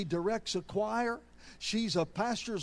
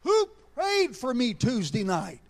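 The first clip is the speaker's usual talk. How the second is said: louder, insistent questioning; demanding; 2 semitones higher, 5 dB louder than usual. Retold or imitated. Daughter asks her father a second time, demanding. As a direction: demand an answer like a cross-examining attorney.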